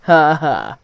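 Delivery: loud, mocking story-character laugh; acted laugh. A narrator's reading. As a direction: laugh out a spelt-out, teasing ha ha. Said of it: "laughed Gordon".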